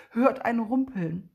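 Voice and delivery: ordinary delivery, nothing marked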